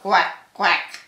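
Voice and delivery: short, punched quacks; lightly voiced storybook duck. A narrator's reading. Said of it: "said the duck".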